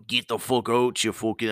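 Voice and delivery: badly faked Scottish cop; horrible Scottish accent. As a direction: put on a clumsy Scottish accent. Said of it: "it's a horrible Scottish accent".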